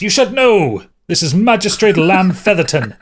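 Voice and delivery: high, loud, pompous announcement; indignant henchman prince. Acted. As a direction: proclaim the magistrate's title in outrage. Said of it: "Just the way he said that".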